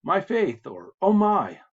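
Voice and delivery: "Oh my" blurted high and loud; mock surprise. Shown as a demonstration. Teacher translating an exclamation with mock surprise. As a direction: act startled, then exclaim. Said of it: "kind of an exclamation of surprise".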